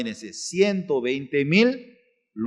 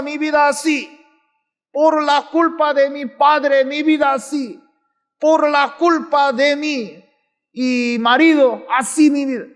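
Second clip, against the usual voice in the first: high-pitched, blaming lament; complaining; 9 semitones higher, 3 dB louder than usual. Acted. Preacher mimics people blaming their family, complaining. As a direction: wail out each excuse high and insistent. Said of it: "¿cómo piensan?".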